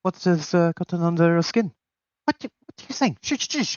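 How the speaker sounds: high-pitched, puzzled question, then hushing; Pierre taken aback. Acted. Man with a book hears someone swearing, high puzzled voice then shushing. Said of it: "rolls over and just goes".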